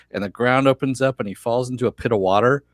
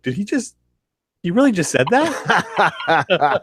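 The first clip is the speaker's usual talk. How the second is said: stunned moviegoer; 8 semitones higher, slower than usual. Retold or imitated. Audience reacting to bad one-liner.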